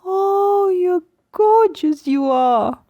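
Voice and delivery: starts very high and falls all the way down, slow; baby talk melody. Shown as a demonstration. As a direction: sing it high then let it fall. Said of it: "something like this".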